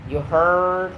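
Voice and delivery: drawn-out Southern "heard"; lightly hammed Southern accent. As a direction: put a Southern twang on it. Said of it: "southern accent you ever heard?".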